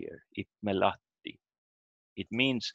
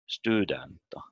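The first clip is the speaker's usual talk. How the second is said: "studenta" wrongly stressed on STU; stress on first syllable. Shown as a demonstration. Teacher shows the mistake, "studenta" stressed on STU. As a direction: shift the weight to the start of "studenta". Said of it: "It's not".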